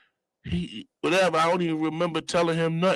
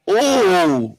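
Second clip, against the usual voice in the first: higher, drawn-out oh; acted amazement; 3 semitones higher, 4 dB louder than usual. Passenger seeing the bills, impressed drawn-out oh. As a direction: react to the cash with a long oh. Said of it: "I was like".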